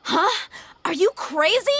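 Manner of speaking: high alarmed outburst; shocked. Acted. Hero shocked as the captive hurts himself, high alarm. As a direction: blurt it out in alarm. Captive bites his own tongue.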